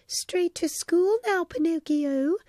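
high fairy voice, warning tone. A narrator's reading. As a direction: send him off with a firm warning. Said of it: "she warned".

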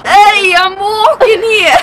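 loud, high New York holler; New York accent. Acted. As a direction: yell it slowly as a New Yorker. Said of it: "New York".

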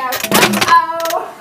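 loud squealed uh-oh; a big playful uh-oh for the baby. Pretend. Grandma to the baby, a loud playful uh-oh.

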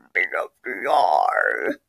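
slow, soft bottomed-out voice; very low drone. Acted. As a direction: drop to the lowest pitch and drag it out. Absurd suggestion in skit.

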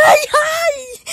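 high, loud yelp of pain; pained cry. Real, not acted. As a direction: cry out as if hit. Being hit.

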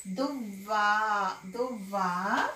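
slow, drawn-out Duvva with the doubled వ held; slow blended reading. Shown as a demonstration. Teacher to young pupils, blending syllables slowly. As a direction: sound out Duvva slowly for beginners. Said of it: "మూడు అక్షరాలు కలిపి చదవాలి".

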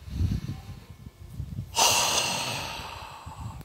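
deep breath, long sigh out; empty a full belly of air noisily; energetic cleansing exhale; big release sigh. Shown as a demonstration. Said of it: "really make that belly fill up".

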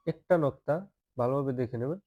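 ordinary talk

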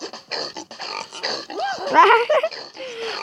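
high, soft giggle of glee; delighted laugh. Real, not acted. Gamer laughing as the character flies, high giggle. Laughs while flying in game.

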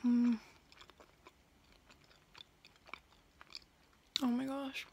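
talking while eating; chewing. Real, not acted. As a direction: mouth full of food. Seen 0: Bite at -7, chewing till 7.6; later speech looks clear.

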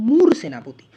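মূর সেনাপতি with a loud, closed moor first; correct pronunciation. Shown as a demonstration. Teacher repeating the proper form to remember, correct pronunciation. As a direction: lean on মূর, no vowel after r. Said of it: "এর উচ্চারণটা হচ্ছে".

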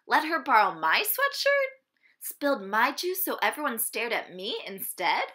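ordinary delivery

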